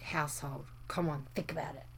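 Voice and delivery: soft low whispered hint; whispering hint. Real, not acted. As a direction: breathe the hint quietly. Hint given very softly.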